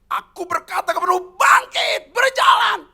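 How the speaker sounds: high, loud command to rise; commanding shout. Retold or imitated. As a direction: proclaim healing with full force. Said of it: "Lalu dia teriak".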